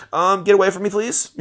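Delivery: high, prissy brush-off; mock rejection. Girl brushing off the artist, mock high voice. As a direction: lightly voice a girl shooing him away.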